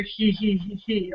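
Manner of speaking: soft little hee hee giggle; innocent giggle. Acted. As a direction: giggle softly like a kitten. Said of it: "the little innocent kitty laughter".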